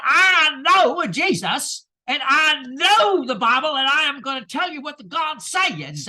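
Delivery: put-on loud, slow Southern preacher accent, building to a shout. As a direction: thunder it like a fire-and-brimstone Southern preacher. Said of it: "says in one of those kinds of accents".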